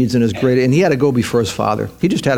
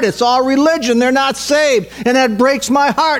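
much higher, urgent and animated plea to the Father; Jesus pleading in prayer; 10 semitones higher than usual. Retold or imitated. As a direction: pour out his heart, arms waving. Voices Jesus praying animatedly.

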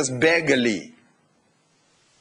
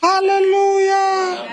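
hallelujah shout; 3 dB louder, slower than usual. Real, not acted. Preacher's climactic exclamation.

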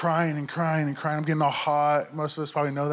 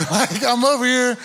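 laughing; 7 semitones higher, 4 dB louder than usual. Real, not acted. Laughing at own story.

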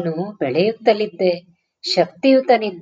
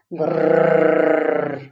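long, drawn-out low growl; dog growl; 3 semitones lower, much slower than usual. Retold or imitated. Boy hearing the neighbour's chained dog, low growl. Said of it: "ನಾಯಿಯ ಪರದಾಟ".

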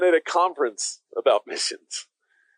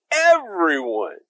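"Everyone" drawn out slowly and a little louder; stressed everyone; 5 dB louder, much slower than usual. Shown as a demonstration. Pastor voicing Paul and Phoebe's point, slow stressed everyone. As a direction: stretch "Everyone" and let it land. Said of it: "the emphasis is on the everyone".

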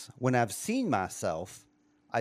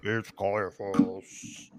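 slow, low, stretched-out mock bass; a slow hammed-up low villain voice; low mock Caiaphas; 5 semitones lower, much slower than usual. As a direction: drop into a lazy fake bass voice. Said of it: "he sings in that low-pitched voice".

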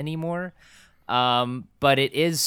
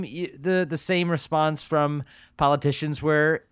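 ordinary talk

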